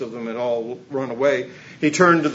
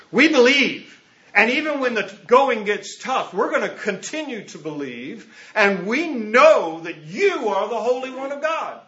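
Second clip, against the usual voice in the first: fervent declaration; 8 semitones higher than usual. Acted. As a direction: proclaim the faith boldly. Preacher declaring disciples' faith.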